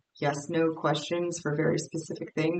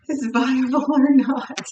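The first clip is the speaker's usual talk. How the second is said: higher and louder, breaking into a laugh; 7 semitones higher, 9 dB louder than usual. Real, not acted. Reader cracking up at her own stumble, laughing. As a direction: laugh through the words after tripping on them.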